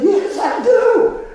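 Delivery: high, drawn-out delighted yes; eager acceptance. Retold or imitated. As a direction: answer with re-enacted glee, stretching the words. Re-enacts eager reply.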